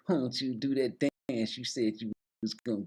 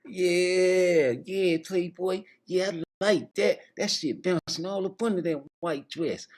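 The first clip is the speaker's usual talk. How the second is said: higher, egging-on voice; 7 semitones higher than usual. Acted. Host egging the dancer on in a raised voice. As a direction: cheer her on up high. [name] cheering, high voice.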